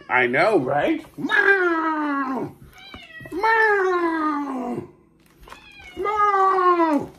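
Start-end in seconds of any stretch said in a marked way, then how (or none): 1.2-7.1 very high shouted meow; cat meowing. Acted.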